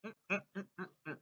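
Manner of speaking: soft fading mm-mm of enjoyment; savoring hum. Real, not acted. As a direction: hum with pleasure while chewing. Savoring the chips.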